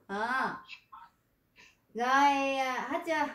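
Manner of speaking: normal talking voice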